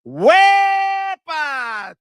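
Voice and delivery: long, high, loud whoop, stretched out; celebratory holler. Real, not acted. Streamer hailing a super chat donor, celebratory holler. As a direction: belt it out, holding the first syllable.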